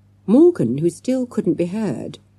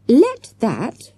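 firm, louder and higher reprimand; stern; 7 semitones higher, 5 dB louder than usual. A narrator's reading. Teacher scolding the children, stern voice. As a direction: lay it down sternly. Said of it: "said Mister Jones sternly".

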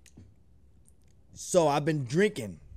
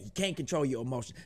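much softer and fast, tossed off under the breath; quick low aside; 5 semitones lower, 9 dB quieter, faster than usual. Real, not acted. Reactor undercutting his own lesson, quick low aside. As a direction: undercut it in a rushed throwaway.

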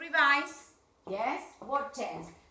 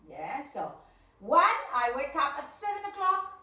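louder, deliberate teacher talk; modelling a routine; 4 dB louder, slower than usual. Shown as a demonstration. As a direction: present your day clearly to young pupils. (la maestra presenta su rutina como modelo)

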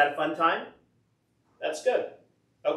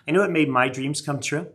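ordinary delivery

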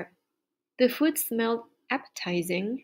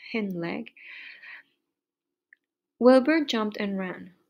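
ordinary delivery

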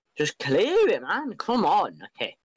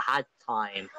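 ordinary delivery

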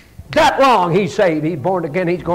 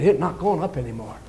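quiet retelling; 6 semitones lower, 6 dB quieter, faster than usual. Retold or imitated.

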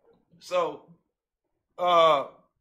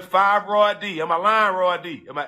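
ordinary delivery

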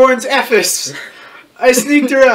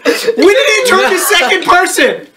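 high-pitched, laughing protest; incredulous outburst; 6 semitones higher than usual. Real, not acted. Friend objects to the story switching person, incredulous outburst. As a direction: exclaim in amused disbelief.

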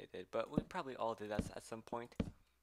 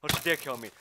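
scared scream; 12 dB louder than usual. Real, not acted. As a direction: scream in fright. Scared by monster.

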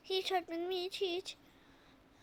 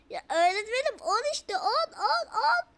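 rising higher and louder each "on"; frustrated kid yelling on; whiny protesting child; 6 semitones higher, 4 dB louder than usual. Acted. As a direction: insist "on" climbing to a high wail.

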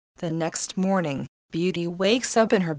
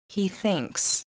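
plain reading voice

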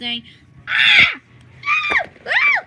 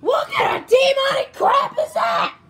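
loud, high outburst of disbelief; play-acted horrified shout; shocked yell; 5 semitones higher, 6 dB louder than usual. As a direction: yell it in pretend alarm.

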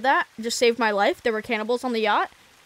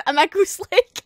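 breathless high laughter; can't stop laughing; 12 semitones higher, slower than usual. Real, not acted. Laughing fit.

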